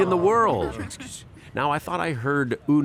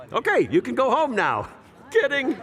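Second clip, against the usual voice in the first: mock dismissal; 10 semitones higher than usual. Joking to tourists.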